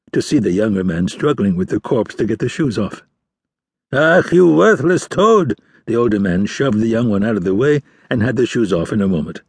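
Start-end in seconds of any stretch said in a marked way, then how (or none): 3.9-5.6 slow, louder, higher scold; angry scolding. Acted. Old fisherman scolding his son for fumbling the shoes. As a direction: spit the insult at a useless son.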